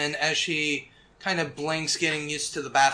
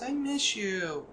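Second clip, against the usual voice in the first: a touch sad; 5 semitones higher, 6 dB quieter, slower than usual. Acted. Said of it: "She kinda looks a little sad".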